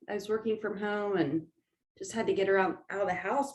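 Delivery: usual speaking voice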